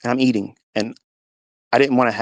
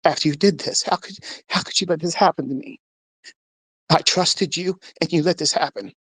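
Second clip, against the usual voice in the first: higher-pitched hurt plea to God; anguished questioning; 6 semitones higher than usual. Retold or imitated. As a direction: plead with God, pitch raised. Questioning God after the miscarriage.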